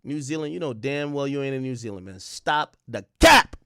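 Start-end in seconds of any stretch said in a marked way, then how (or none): 2.2-3.6 slow, loud, drawn-out call of cap; loud callout. Real, not acted. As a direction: shout it slow to call out the lie. Calling out chat for lying.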